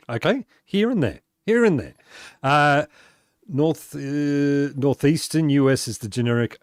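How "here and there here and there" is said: "here and there" pitched high, falling away; showing own pronunciation. Shown as a demonstration. Streamer repeats "here and there" for an admiring viewer, showing own pronunciation. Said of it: "Loves the way you say here and there".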